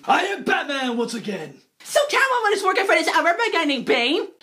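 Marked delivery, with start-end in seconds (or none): 0.0-1.6 low, mock Batman growl; hammed-up low Batman line. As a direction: drop into the Batman voice. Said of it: "So Bruce Wayne is like".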